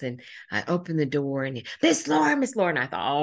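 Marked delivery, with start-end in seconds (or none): 1.8-2.7 much higher, louder eager call; a boy bursting with news; excited calling. Retold or imitated. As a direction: call her name like a thrilled kid.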